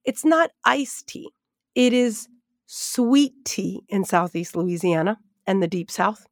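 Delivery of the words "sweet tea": "sweet" drawn out slowly; stress on sweet. Shown as a demonstration. Teacher correcting her Northeast term, stress on "sweet". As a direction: lean on the word "sweet". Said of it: "Emphasis on sweet".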